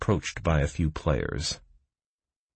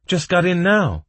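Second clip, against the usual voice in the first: put-on loud, high girl's voice; emphatic, piped-up chime-in; said loudly; 7 dB louder than usual. A narrator's reading. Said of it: "the girl said loudly".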